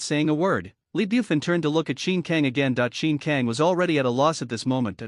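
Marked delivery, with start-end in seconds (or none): none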